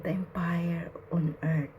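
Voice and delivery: unvoiced hushed whisper. Real, not acted. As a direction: whisper it in awe. Awed aside.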